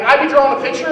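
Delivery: ordinary delivery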